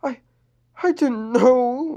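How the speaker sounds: slow high tearful; slow broken girl voice; crying. Acted. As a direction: barely get it out. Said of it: "Lenny cried more tears".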